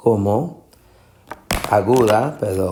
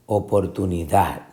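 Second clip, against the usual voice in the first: oportunidad landing on DAD, softer; final stress; 3 semitones lower, 5 dB quieter than usual. Shown as a demonstration. Teacher offering last option, oportuni-DAD. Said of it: "es la sílaba tónica".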